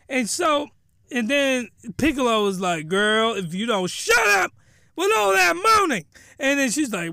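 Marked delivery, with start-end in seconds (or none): 2.9-6.0 mock annoyed husband. Acted husband snapping at noisy wife.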